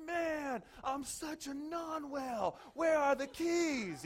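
loud, high, drawn-out frustration; fed-up self-scolding, pitched high; angry outburst. Retold or imitated. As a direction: wail it out in exasperation, stretching the words. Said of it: "I was angry".

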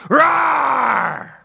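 long, high roar that falls away; mock bear roar. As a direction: roar like a little kid playing bear. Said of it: "you were growling at everybody".

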